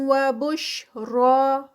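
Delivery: slow, drawn-out tajweed recitation; Quranic recitation. Shown as a demonstration. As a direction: chant it slowly, stretching each sound. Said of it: "شین کی sound". (آہستہ کھینچ کر تلاوت)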